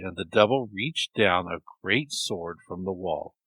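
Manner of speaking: ordinary delivery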